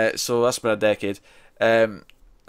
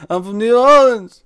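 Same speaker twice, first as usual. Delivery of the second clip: high, loud, slow insisting voice; hammed-up claim to be local; mock Wiseau accent; 11 semitones higher, 8 dB louder, slower than usual. Wiseau insists on his hometown.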